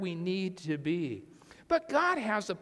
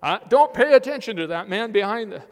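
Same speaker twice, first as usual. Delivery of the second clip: flustered shout; 10 dB louder, faster than usual. Retold or imitated. Wizard caught behind the curtain.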